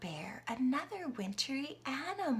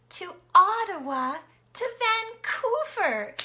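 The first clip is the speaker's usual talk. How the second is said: excited build; 8 semitones higher, 8 dB louder than usual. Acted. Excited listing of cities.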